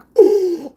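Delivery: loud high gasp of horror; a loud, high gasp of sudden shock; sharp shocked gasp. Retold or imitated. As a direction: suck in air, appalled at yourself. Shocked at own blunt words.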